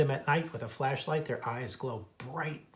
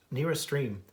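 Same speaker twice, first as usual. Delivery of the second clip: ordinary delivery, nothing marked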